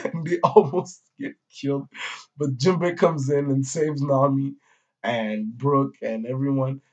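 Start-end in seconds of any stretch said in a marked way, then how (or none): none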